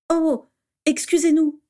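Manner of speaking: put-on startled boy's high yelp of sorry; startled apology. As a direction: blurt it out caught in the act. Boys fall at auror's feet.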